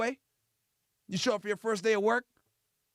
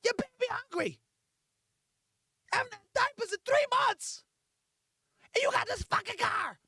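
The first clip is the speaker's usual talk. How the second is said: high-pitched, scolding mother; outraged, high mock nagging; angry baby mama; 12 semitones higher than usual. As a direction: scold him in a high put-on voice. Acted quarrel, angry mother of baby.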